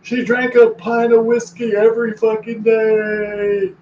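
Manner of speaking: high, slow, blunt mock eulogy; deadpan a shocking funeral line. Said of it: "being like".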